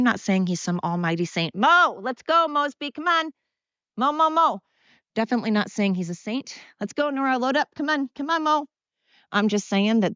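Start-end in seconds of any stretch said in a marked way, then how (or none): 1.5-4.6 high sing-song call to the dog; high coaxing call to a dog named Mo. Real, not acted. Calling her dog to come. 6.8-8.7 high sing-song call to the dog; high coaxing call to a dog named Mo. Real, not acted. Calling dogs to load up.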